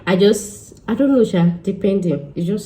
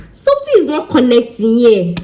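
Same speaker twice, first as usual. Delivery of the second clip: frustrated insisting; 6 semitones higher, 5 dB louder than usual. Retold or imitated. Stressed, insisting to client.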